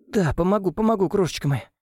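high, tearful promise; sobbing. A narrator's reading. Weeping nanny promises Ilya to help, a touch of sobbing. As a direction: say it through sobs, lightly voiced. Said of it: "всхлипнула Ангелина".

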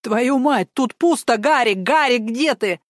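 high, loud panicked cry; panicked shout. Acted. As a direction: shout in alarm at the empty safe. Panic at empty safe.

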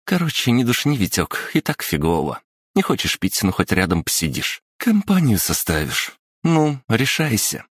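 lively schoolgirl voice. Acted. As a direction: cajole a shy classmate. Yulia voiced, coaxing. (бойкая школьница уговаривает)

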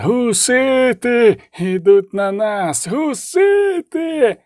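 very high, long-drawn yells; wailing high shout that climbs at the end; screamed alarm. A narrator's reading. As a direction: raise the alarm, stretching each cry. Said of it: "зарепетував, галопуючи мимо".

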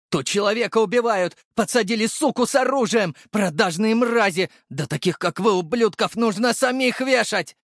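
high, furious rant; outraged tirade. A narrator's reading. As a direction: yell accusations at the guards. Prisoner rages at guards. (возмущённая гневная тирада на высоких тонах)

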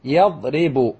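يضرب carefully as yad-ri-bu; teacher modelling يضرب, kasra in the middle; middle vowel I. Shown as a demonstration. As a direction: sound out يضرب with a clear I. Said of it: "فعل يفعل مثل ضرب".

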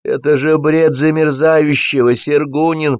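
high, drawn-out lament; anguished ranting. A narrator's reading. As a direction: wail the criticism theatrically, slowly. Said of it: "продолжал с закрытыми глазами Сапфиров".

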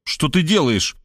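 plain reading voice

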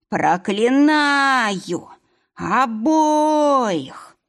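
медленно, выше, зловещий вой; выть проклятие, как нежить; проклятие призрака. Acted. Ghost curses both men. (зловещее проклятие призрака)

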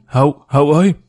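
mild narrated call to someone away; understated worried summons; trembling loud call. A narrator's reading. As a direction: call out the name, lightly anxious. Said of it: "run rẩy gọi lớn".

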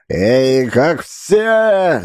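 high staggering yell; drunken yelling. A narrator's reading. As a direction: bawl it out drunk. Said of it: "завопил еле стоящий на ногах боцман".

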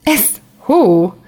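amused, a light chuckle in it; chuckling. A narrator's reading. Daniel teasing the girl, chuckling. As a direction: tease her with a small laugh. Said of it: "közölte kuncogva Daniel".

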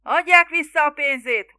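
loud, high demand yelled out; angry crowd shout. A narrator's reading. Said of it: "ordították". (dühös, hangos kiabálás)

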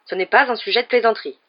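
a touch of cold disapproval; coldly. A narrator's reading. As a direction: reprove them stiffly. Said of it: "disait-il avec froideur".

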